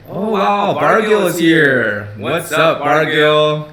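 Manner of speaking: loud, drawn-out cheer for a viewer; excited greeting. Real, not acted. Greeting viewer Bargill joining the stream, loud excited welcome. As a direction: hail a regular who just showed up.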